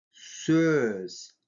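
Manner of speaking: Сөз with a fronted, rounded ö; soft rounded Ө. Shown as a demonstration. Teacher showing a soft lip vowel, Сөз with fronted ö. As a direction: say Сөз with lips pushed forward, tongue forward. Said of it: "Мягкий губной звук".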